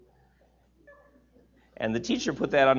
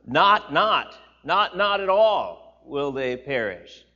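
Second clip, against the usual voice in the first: louder, slow, each not hammered; emphatic not; 7 dB louder, slower than usual. Shown as a demonstration. Pastor showing the Greek double negative, slow and hammered. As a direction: drive home every not, slowly. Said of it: "makes it very emphatically negative".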